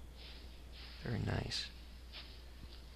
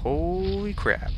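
high, drawn-out amazed exclaim; holy crap; 10 semitones higher, 6 dB louder, much slower than usual. Real, not acted. Gamer gaping at the terrible new armor, stunned. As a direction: stretch it out in shock. Sees the breastplate stats.

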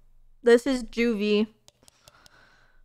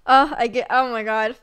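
normal talking voice

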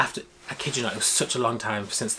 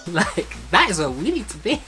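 higher, louder eager voice; excited; 7 semitones higher, 5 dB louder than usual. Retold or imitated. Friends deciding they belong in the pit, excited. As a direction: demand your place. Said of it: "we were like".

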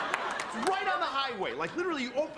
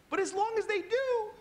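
hammed-up outrage; 12 semitones higher than usual. Comedian ranting at the hotel in hammed-up fury. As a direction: fake fury, laughing through it. Mock outrage rant on stage.

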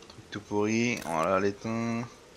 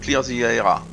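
hammed-up English accent, a bit louder; mock English accent; 4 dB louder than usual. Gamer reading the quest text to viewers, in a mock English accent. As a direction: read the quest line like a proud Englishman. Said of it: "Avec mon super accent anglais".

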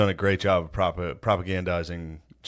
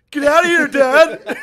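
high, loud shriek at Dad; high-pitched protesting shout; panicked teen yell; 10 dB louder, slower than usual. Acted. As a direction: yell it like you've been walked in on. Acted teen yelling at intruding dad.

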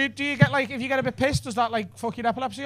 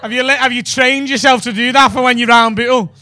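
usual speaking voice

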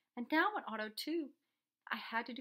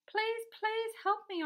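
high, loud, drawn-out begging; urgent storybook cry for help; desperate plea; 11 semitones higher, 7 dB louder, slower than usual. A narrator's reading. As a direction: beg for rescue, stretching each please. Boy begs Mister Carp by phone.

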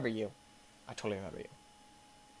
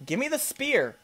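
higher, louder demand; real annoyance shouted at the game; frustrated yell; 11 semitones higher, 5 dB louder than usual. Real, not acted. Player yelling at the game for the spear.

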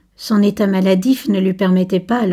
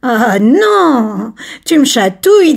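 high, loud, giggly protest; laughing protest; 3 semitones higher, 7 dB louder, slower than usual. Acted. Camille is seized by Laurent and laughs, tickled. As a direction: squeal as if tickled. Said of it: "Le commis éclata de rire".

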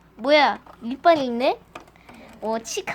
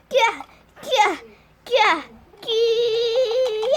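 straining squeals; 7 semitones higher, slower than usual. Acted. Straining, being pulled out.

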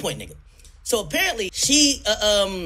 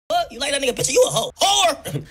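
mock insult; 6 semitones higher than usual.

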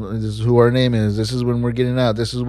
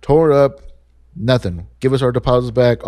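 usual speaking voice

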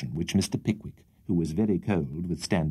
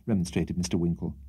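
ordinary delivery, nothing marked

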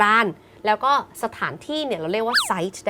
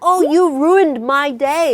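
higher, louder, drawn-out mock protest; acted complaint; 7 semitones higher, 8 dB louder, much slower than usual. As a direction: wail it as a hammed-up accusation. Acted example of ruin complaint. (แกล้งโวยวายตัดพ้อ เสียงสูงดัง ลากยาว)

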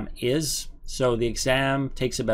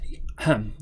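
throat clearing; 2 semitones lower than usual. Real, not acted. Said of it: "excuse me".

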